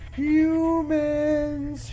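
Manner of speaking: high, sung-out lilting call; eerie high sing-song; sing-song monster call. Acted. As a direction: croon it sweetly like a lurking monster. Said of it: "she sang".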